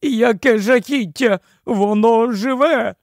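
high, alarmed queenly cry; shrieking queen aghast at a creature; horrified wailing. A narrator's reading. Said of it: "заволала королева".

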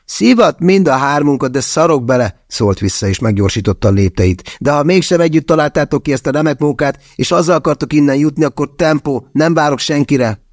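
sneering, walking away; defiant Andrej. A narrator's reading.

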